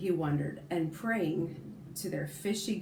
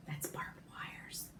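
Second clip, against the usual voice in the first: whispered aside; 13 dB quieter, slower than usual. Real, not acted. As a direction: lean in and whisper it slowly. Aside to listening kids.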